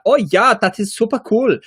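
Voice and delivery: hammed-up comic German, pitched high and punched out; comedic German accent. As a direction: put on a cartoonish German accent. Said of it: "comedic German".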